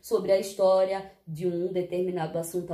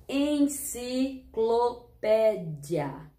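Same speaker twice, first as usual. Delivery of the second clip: very slow, syllable by syllable; drawn out; sounding out words; much slower than usual. Shown as a demonstration. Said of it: "Mas vamos treinar comigo?".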